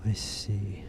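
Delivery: soft whispered reply; whispering. Pretend. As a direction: whisper back to the ghost. Answers ghost's whisper.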